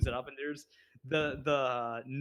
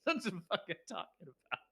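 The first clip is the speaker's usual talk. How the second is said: put-on Jerome accent; 5 dB quieter than usual. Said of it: "with his Jerome accent".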